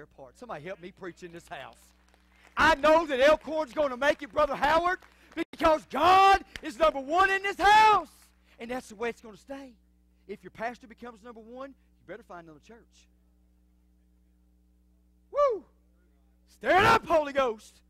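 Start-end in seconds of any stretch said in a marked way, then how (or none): 2.6-8.1 loud, high preacher's cry, slowing at the end; full-force preaching. Acted. 15.3-17.8 loud, high whoop; preacher's shout. Acted. Preacher calling out.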